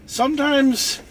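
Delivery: normal talking voice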